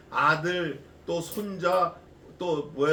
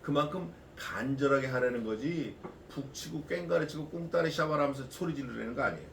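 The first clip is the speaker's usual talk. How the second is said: usual speaking voice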